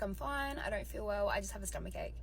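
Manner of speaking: soft, subdued retold excuse; super quiet. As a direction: brush off the questions quietly. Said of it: "So I'm super quiet".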